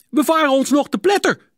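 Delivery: gillend hoog en luid; paniekerige schreeuw. A narrator's reading. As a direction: schreeuw in paniek voor een botsing. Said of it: "schreeuwde Hielke". (angstige, schelle schreeuw)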